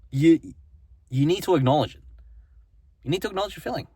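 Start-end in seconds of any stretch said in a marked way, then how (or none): none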